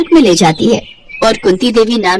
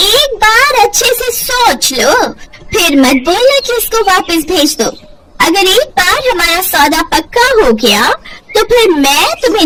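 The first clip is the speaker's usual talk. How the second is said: scheming buyer woman; 6 semitones higher than usual. Acted. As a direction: drag out each condition like a shady trader. Kunti Devi bargaining for the girl.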